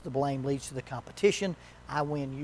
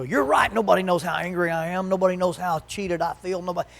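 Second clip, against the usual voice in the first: mock outburst; 3 semitones higher, 5 dB louder than usual. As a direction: voice jumps high and loud, then pours out the grievance. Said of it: "And they go".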